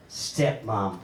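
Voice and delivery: a sour echo of Stepmom; low, drawn-out mock disdain. Shown as a demonstration. To the crowd, repeating the word with low scorn. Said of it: "I also had a stepmom".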